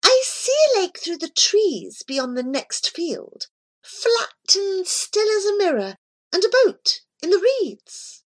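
high, light voice of the cat. Acted. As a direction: voice the cat Miss Scurry high and airy. Narrator voicing the cat.

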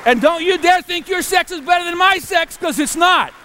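high, loud comic outburst; mock-indignant challenge. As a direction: challenge the doubters at full voice. Mock challenge to doubting audience.

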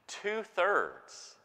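"Two-thirds" lifted high and punched; emphatic repeat. Shown as a demonstration. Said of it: "two-thirds of the world's English speakers".

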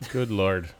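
lightly put-on Gangs of New York Irish accent; attempted Irish accent. Joking with podcast friends about the film, attempted Irish accent. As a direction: have a rough go at an Irish accent. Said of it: "Is that your Gangs of New York accent".

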